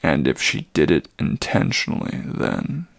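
put-on an old creature barely holding his agitation; agitated old centaur. Old Chiron to the boy, low troubled voice.

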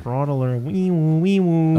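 high siren imitation on wee woo; mock alarm. Player teasing self-declared fraud, mock alarm. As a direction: sound the alert like a toy siren.

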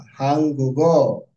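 हंगुगो very slow, with a clear ng; confirming model pronunciation. Shown as a demonstration. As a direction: say हंगुगो drawn out for the learner. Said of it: "हान नहीं, हंगुगो". (धीमा सही उच्चारण, हंगुगो में ङ)

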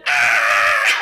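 loud retold cheer of a jumping crowd; crowd roar. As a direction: belt out the crowd's joyful roar. Crowd jumps up as one.

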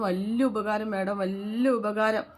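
slow, drawn-out gratitude; effusive thanks. Retold or imitated. Maid thanking madam for the money, effusively grateful. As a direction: stretch the words out with acted thankfulness.

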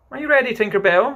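put-on boy calling out to his fairy, loud and high. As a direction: call her from across the room. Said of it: "he called out".

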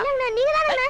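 high, tearful protest; whiny denial. Acted. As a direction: whine the blame back at him. Accused boy protests to elder.

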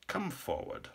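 soft, high rasp; rasping old king. A narrator's reading. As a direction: rasp a faint royal summons. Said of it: "rasped the old king".

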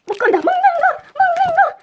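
gleeful high cry about food; excited squeal. Real, not acted. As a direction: squeal with delight over snacks. Delighted at food gift.

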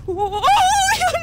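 high, loud, drawn-out "oh"; frightened scream. Real, not acted. Player caught in a scare, high loud scream. Startled scream during the game.

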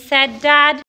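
ordinary delivery

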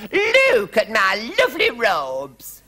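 high, loud, drawn-out wail; moaning queen. A narrator's reading. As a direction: lament it slowly as a distraught queen. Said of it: "moaned the Queen".

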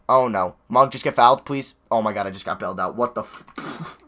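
normal talking voice

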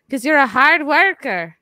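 loud, playful Irish brogue; mock Irish accent. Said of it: "your Irish accent".

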